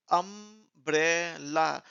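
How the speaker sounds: अम्ब्रेला slowly in three parts, am-bre-la; teacher sounding out "अम्ब्रेला"; syllable by syllable. Shown as a demonstration. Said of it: "छोटी-छोटी parts में आप pronounce".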